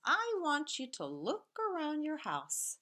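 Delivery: ordinary talk, nothing marked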